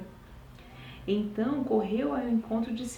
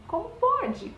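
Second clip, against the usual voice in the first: incredulous; 9 semitones higher, 4 dB louder, slower than usual. Real, not acted. Amazed at disciples forgetting.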